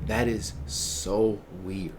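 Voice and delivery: low, soft, slow verdict; quiet disbelief. Real, not acted. Streamer judging the girl's behaviour, low quiet disbelief.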